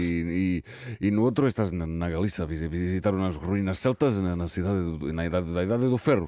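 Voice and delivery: hesitant Portuguese with a put-on Spanish accent; comic Spanish-accented Portuguese. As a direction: stumble through it like a Spanish-accented pigeon interviewee. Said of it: "o sotaque espanhol".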